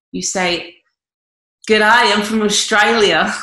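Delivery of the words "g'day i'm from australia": loud, slow hammed-up Australian; mock Australian accent. Coach voicing an Australian introducing himself, hammed-up Australian accent. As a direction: put on a big Australian accent. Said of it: "quite entertained by your Australia".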